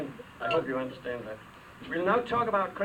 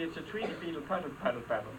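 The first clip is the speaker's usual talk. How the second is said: fast; much faster than usual. Pretend. Tongue twister rattled off.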